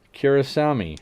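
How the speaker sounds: normal talking voice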